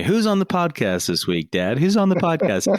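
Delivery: high, eager imitation of his kids; kids asking. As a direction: pester like a curious child. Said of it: "my kids always ask me".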